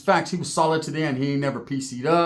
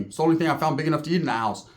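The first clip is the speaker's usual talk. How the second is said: ordinary delivery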